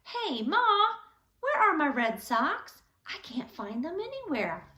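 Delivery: put-on boy calling out loudly for his mother; shouted call. A narrator's reading. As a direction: call out to Ma across the house, loud. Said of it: "her son Henry had shouted".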